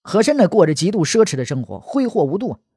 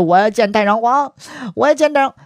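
high, wailing plea rising on the repeat; mock crying; 5 semitones higher than usual. He Shen begging to see the retired emperor, mock crying. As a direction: plead in a hammed-up tearful voice. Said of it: "哭着说".

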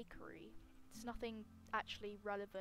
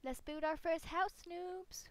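louder, higher hype; excited; 6 semitones higher, 3 dB louder, slower than usual. Real, not acted. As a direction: call it out. Hyped call to viewers.

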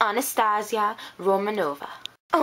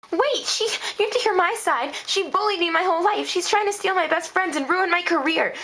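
high, urgent pleading; desperate protest; 6 semitones higher than usual. Acted. Girl begging the photographer to hear her side, desperate. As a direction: plead your case before they walk away.

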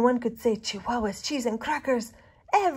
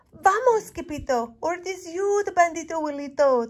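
much higher, loud panicked shout; frightened cry; 9 semitones higher, 8 dB louder than usual. A narrator's reading. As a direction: yell a warning as they flee. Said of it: "they cried".

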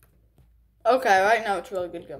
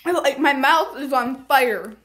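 usual speaking voice

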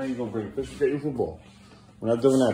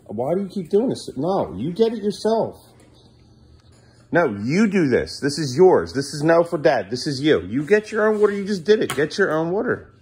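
raised, emphatic parental insistence; exasperated scolding; 6 semitones higher, 4 dB louder than usual. Real, not acted. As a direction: insist firmly, leaning on the key words. Scolding child to do it himself.

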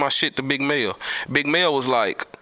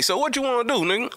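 imitating Big Mel; 10 semitones higher than usual. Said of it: "He say".

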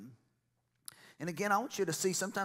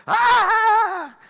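long, high, very loud scream; excited scream; 15 dB louder than usual. Acted. Preacher acting out believers' excitement, screaming. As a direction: let out a sustained high holler.

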